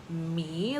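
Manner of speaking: ordinary delivery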